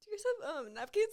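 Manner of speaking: high, tearful request; really crying. Real, not acted. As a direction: ask for help through tears. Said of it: "Why am I crying?".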